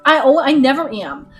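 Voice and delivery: ordinary talk, nothing marked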